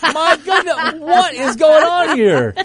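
laughing in disbelief; high, laughing through the words. Real, not acted. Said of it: "Now I wanna cough".

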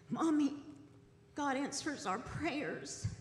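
real tears held back, high and slow; quoting her son while close to real tears; fighting back tears. Real, not acted. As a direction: voice catching as she holds back crying. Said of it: "I'm gonna try to say this without crying".

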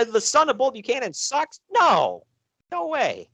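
high-pitched, put-on incredulity; mock disbelief. Reacting to the wrestler's son sucking, mock disbelief. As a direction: act astonished, pitch jumping way up.

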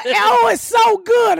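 high, slow gushing praise; mock gushing guest. Imitating guests praising the cake, high slow gush. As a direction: gush over the cake, hammed up.

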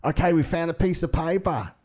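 hammed-up casual police announcement; laid-back police appeal. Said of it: "public appeal in the paper going like".

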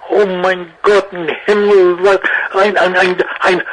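hammed-up German gushing over a dog; over-the-top German accent, delighted. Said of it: "I had to do the accent".

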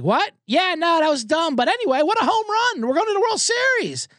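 high, gushing, dismissive friends; excited friends. Retold or imitated. Friends brush off his complaint, high and gushing. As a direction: gush over the home run. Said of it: "And they're like".

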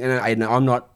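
usual speaking voice

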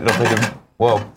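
ordinary delivery

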